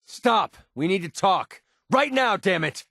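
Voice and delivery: high, sharp angry yell; angry demand. Acted. Companion demands the player stop and talk, angry shout. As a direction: force him to stop and listen.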